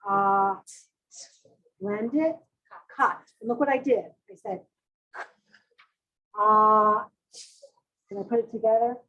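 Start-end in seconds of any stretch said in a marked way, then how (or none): 0.1-0.6 AH as a separate held sound between k and t. Shown as a demonstration. Teacher segments cot into three sounds for pupils, sounded out. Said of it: "say the three sounds you hear". 6.4-7.0 AH held alone, louder, split from k and t; broken apart. Shown as a demonstration. Teacher shows how to break cot apart, sounded out. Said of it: "first we break it apart".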